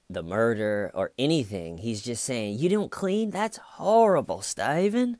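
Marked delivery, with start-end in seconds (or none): 2.6-5.2 high-pitched appalled detective impression; hammed-up disbelief, pitched way up; mock horror. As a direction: mimic a scandalised detective, drawing out the shock. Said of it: "detective is just telling him that it's horrible".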